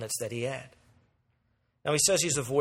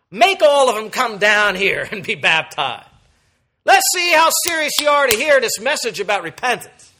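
crying out; 7 semitones higher, 11 dB louder than usual. Acted. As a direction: call the crowd down to be baptized. John crying out in wilderness.

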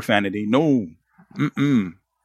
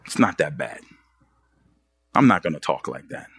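lower, dismissive mock excuse voice; muttered, lower imitation of a lame excuse; imitating excuses; 7 semitones lower than usual. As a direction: shrug it off like a self-justifier. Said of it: "you know, saying".

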